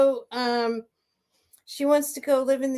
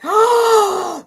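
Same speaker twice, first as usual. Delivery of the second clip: big gasp, high and loud; 10 semitones higher, 8 dB louder than usual. Real, not acted. Realising the news is real, a loud gasp. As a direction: gasp as if you can't believe it.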